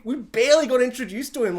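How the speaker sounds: ordinary talk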